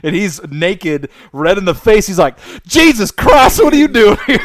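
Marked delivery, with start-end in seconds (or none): 2.7-4.4 high, loud Southern twang, caught off guard; startled Southern roommate. Retold or imitated. Naked roommate to the man walking in, panicked Southern twang. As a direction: yelp it in a Southern accent. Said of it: "Southern accent and all".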